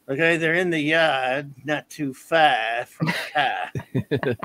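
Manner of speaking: put-on slow, drawn-out Boston accent, R's dropped. Quoting Jaws on talking like Boston, Boston accent. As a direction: stretch "yahd", "fah" and "cah" Boston style. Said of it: "They talk like a, like Boston".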